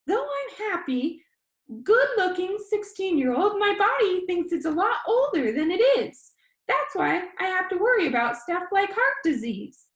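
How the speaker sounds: high, loud, chatty vlogger; high squeaky boy. Acted. Danny tells his viewers about his disease in a high squeaky voice. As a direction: rattle it off like an upbeat kid vlogger. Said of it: "His voice was high and squeaky".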